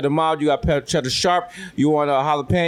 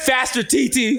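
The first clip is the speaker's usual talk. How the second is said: high-pitched shout across a field; hammed-up urgent yell; calling out; 8 semitones higher, slower than usual. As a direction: holler encouragement at a struggling relative. Acted calling out to the raker.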